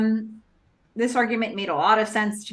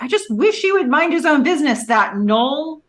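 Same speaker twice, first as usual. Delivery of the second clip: higher, louder mock complaint; irritated outburst; 8 semitones higher, 6 dB louder than usual. [name] changes the subject to complain about Noel, irritated. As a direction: voice [name]'s exasperation, pitched up and loud.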